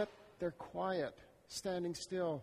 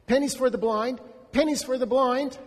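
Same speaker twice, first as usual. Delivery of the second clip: loud begging call, pitched up; calling out for alms; 7 semitones higher, 10 dB louder than usual. Acted. Blind beggar calls to the crowd for pennies, loud. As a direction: beggar hollering to passers-by.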